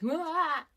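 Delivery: short high startled wah; mock shocked yelp. Imitating a viewer shocked by a horror film, loud yelp. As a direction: yelp as if the screen just hit you.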